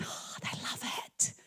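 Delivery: ordinary delivery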